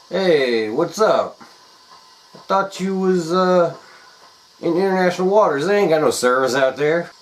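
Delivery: high, loud fake-casual voice; nervous caller. Pet sitter on the phone to Jim, high fake-casual cover. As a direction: cover panic with a breezy tone.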